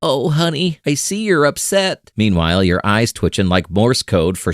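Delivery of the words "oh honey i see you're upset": high, sugary forced-sweet soothing; syrupy fake calm, pitched high; fake calm through gritted teeth. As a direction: sound serene while seething underneath. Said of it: "smiling through gritted teeth".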